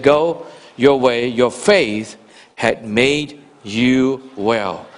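low, slow, gentle voice of Jesus; Jesus calmly healing the blind man, low and slow. Retold or imitated. Preacher voicing Jesus.

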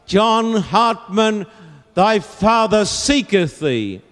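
high, drawn-out searching call; crying out. Acted. Old Quaker calling to his son among soldiers, crying out. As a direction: cry the name slowly across a distance. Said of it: "and he'd cry".

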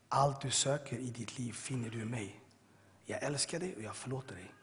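low, soft, tender divine voice; voice of God. Retold or imitated. God forgiving the prisoner in his cell, soft and low. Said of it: "Tredje gången så hör jag samma röst".

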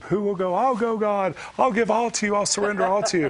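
high, loud mock-fervent pledges; imitating fervent worshippers. Worshippers pledging all to God, acted fervour. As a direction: cry out like an eager worshipper. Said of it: "those times in worship".

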